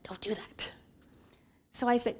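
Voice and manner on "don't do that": hushed prompting. Retold or imitated. Holy Spirit stopping her refusal, hushed prompting. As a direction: whisper it like a voice inside.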